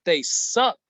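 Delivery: "suck" punched at the end; "suck" stressed with a jump upward; emphasis on suck. Real, not acted. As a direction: lean hard on "suck". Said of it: "I'm emphasizing the word suck".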